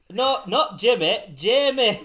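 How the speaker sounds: loud, high, laughing northern English; mock northern accent. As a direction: correct the name in an exaggerated northern accent. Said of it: "That's the northern accent".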